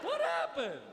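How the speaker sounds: high, loud shocked question through a laugh. Retold or imitated. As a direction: squeal it in disbelief. Shocked at goth kids.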